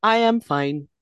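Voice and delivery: lightly voiced robot woman; stilted mock-robot delivery; dead-eyed robot lady. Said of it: "dead-eyed robot lady Abby".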